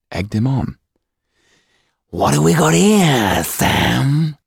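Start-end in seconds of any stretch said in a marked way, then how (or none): 2.1-4.4 slow, higher, louder leering thug voice; a menacing street brute sizing up his prey; fish-eyed monster taunting the hostage, slow and menacing. Acted. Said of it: "said the fish-eyed monster".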